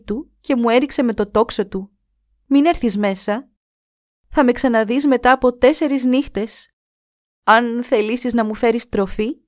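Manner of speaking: ψηλότερη, αργή στην αρχή φωνή γυναίκας που πονά; κράτα τον μακριά, πληγωμένη και σοβαρή; πληγωμένη νεαρή γυναίκα. Acted. Η πληγωμένη σύζυγος μιλά. (η πληγωμένη σύζυγος προειδοποιεί τον άντρα της, ψηλότερη γυναικεία φωνή)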